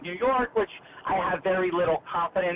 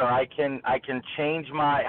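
ordinary talk

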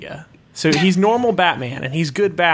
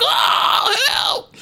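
high, breathy panicked yell for help; hammed-up cry of panic; mock scream. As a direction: shriek like a terrified Batman. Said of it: "screaming in the corner".

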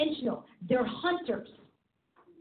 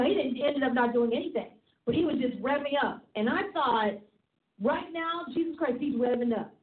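ordinary delivery

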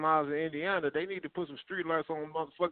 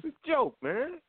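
higher, singsong mock reassurance; teasing; 11 semitones higher than usual. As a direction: tease him with it, mock innocent. Repeats the joke line teasingly.

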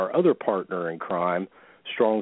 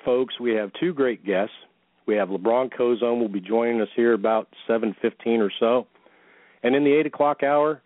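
normal talking voice

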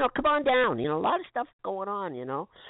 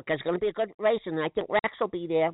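ordinary talk, nothing marked

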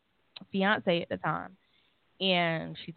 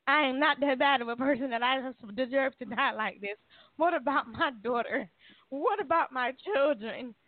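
pleading, angry outburst pitched much higher; a desperate rant at God, a little held back; cursing out God; 6 semitones higher than usual. Retold or imitated. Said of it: "cursing his ass out".

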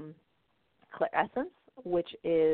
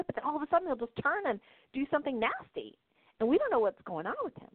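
higher, eager patient voice; patient realizing, higher eager voice; imitating patient; 8 semitones higher than usual. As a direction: recall it in a surprised rush. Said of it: "She goes".

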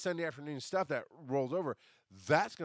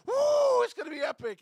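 much higher, louder whoop of hype; mock hype; 10 dB louder than usual. Imitating Terry's hype about the festival, high whoop. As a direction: whoop like an overexcited fan.

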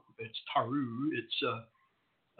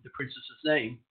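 ordinary talk

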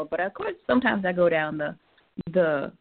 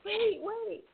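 frantic wait for me; 12 semitones higher, slower than usual. Retold or imitated.

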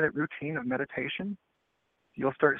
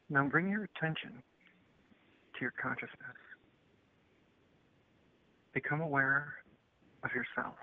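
guided meditation; 11 dB quieter than usual. Acted.

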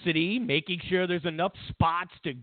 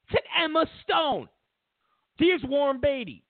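ordinary delivery

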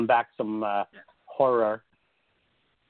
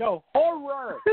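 HORROR drawn out slowly and pitched way up; emphatic correction; 12 semitones higher, much slower than usual. Retold or imitated. Podcaster correcting people who misheard the site name, emphatic correction. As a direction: stretch HORROR high to make it unmistakable. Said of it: "No, absolutely not".

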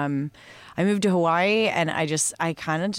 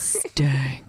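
weird stank voice; 4 semitones lower than usual. Acted. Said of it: "What was that?".

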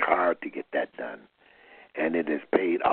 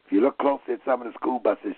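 ordinary delivery

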